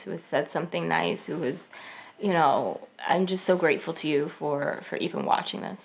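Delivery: soft, low, heartfelt thanks; sincere gratitude. Real, not acted. Speaker thanking kind commenters after six rough months, sincerely grateful. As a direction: let the voice drop low and quiet with real gratitude.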